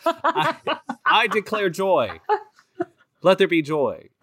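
a light spoof of a grand declaration; mock declaration. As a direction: playfully proclaim it, drawn out and high. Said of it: "he just yells".

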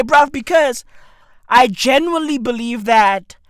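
ordinary talk

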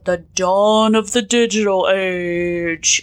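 grandiose. Retold or imitated. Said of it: "I love how they say".

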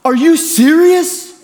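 high, slow, whiny disbelief; Rylan's classic whine. Retold or imitated. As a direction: stretch it out like a put-upon teenager. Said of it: "So Rylan pulls his classic".

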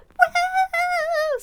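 very high, loud whoop of joy; wild whoop. Retold or imitated. Child bursting into a friend's bedroom, very high and loud whoop. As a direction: let out a wild cheer. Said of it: "And then I'd be like".

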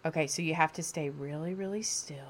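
dropping to a soft whisper; leaning in to whisper. Real, not acted. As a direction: hush down to a whisper. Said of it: "Okay, whisper".

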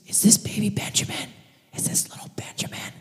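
breathy, hushed acted awe; trembling-with-wonder mock whisper; creepy whisper. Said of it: "creepy governor, this bald freak".

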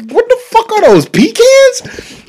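high, incredulous squeak; mock disbelief. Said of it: "I'm like".

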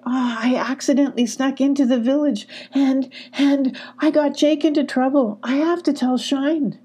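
high, distressed confession; crying. A narrator's reading. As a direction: blurt it out upset, stumbling on and. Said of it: "Emmy cried".

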